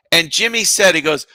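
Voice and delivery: ordinary talk, nothing marked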